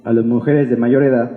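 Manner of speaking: ordinary talk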